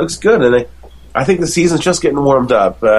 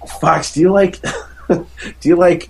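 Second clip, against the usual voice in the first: imitated wife; 6 semitones higher than usual. Imitates wife questioning their son.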